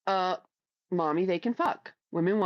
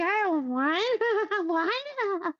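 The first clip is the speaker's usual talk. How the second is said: put-on clueless young woman; 11 semitones higher, much slower than usual. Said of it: "And she'll be like".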